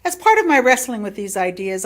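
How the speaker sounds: ordinary talk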